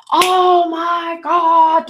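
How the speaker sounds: high, drawn-out mock freak-out; going crazy. Scorpio reacting in the moment, drawn-out mock panic. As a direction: stretch it out like you're losing it. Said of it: "and you can go crazy".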